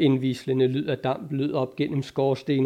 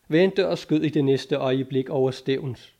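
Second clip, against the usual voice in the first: usual speaking voice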